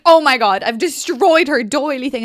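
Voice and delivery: retold loud panicked cry; reliving the horror of the spilled tea; a kid's loud oh-no after spilling tea; panicked exclamation. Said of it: "And then I'm like".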